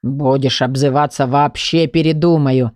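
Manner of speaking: low, slow grumpy threat; quarrelsome. A narrator's reading. Law book threatens to back out, quarrelsome. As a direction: grumble the threat like a crabby elder. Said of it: "сварливо произнёс сборник".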